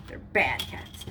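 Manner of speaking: low, breathless aside about the naughty cats; whispered scolding. Real, not acted. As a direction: whisper it to the cats, half to herself. Scolding cats under her breath.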